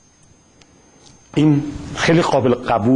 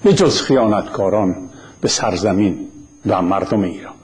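formal address; 2 semitones lower than usual. Acted.

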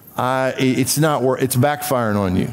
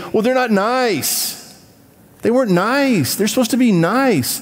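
whiny complainer; 7 semitones higher than usual. Retold or imitated. Mocking complainers in sermon.

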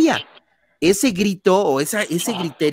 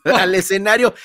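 ordinary talk

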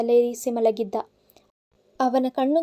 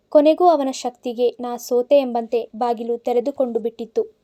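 usual speaking voice

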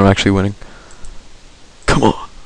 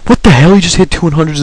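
shocked outburst; 7 semitones higher than usual. Real, not acted. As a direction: burst out at an unfair hit. Shocked by opponent's hits.

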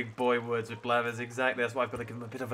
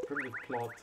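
ordinary talk, nothing marked

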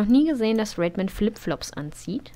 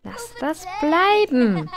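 scolding; 6 semitones higher, 4 dB louder, much slower than usual. Real, not acted. Scolding sim.

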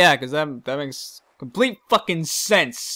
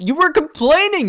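high, loud incredulous protest; indignant outcry; 11 semitones higher, 6 dB louder than usual. Real, not acted. Player protests the peddler's complaining, incredulous and loud. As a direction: call him out in disbelief.

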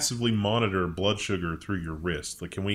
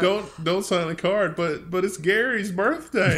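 high, slow comic plea; hammed-up protest breaking toward a laugh; mock pleading coworker; 9 semitones higher, 5 dB louder, slower than usual. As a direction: plead as a put-on coworker, louder and higher. Acts out coworkers over birthday card.

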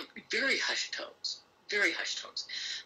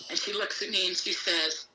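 ordinary delivery

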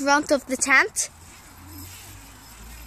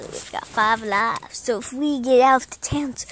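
normal talking voice

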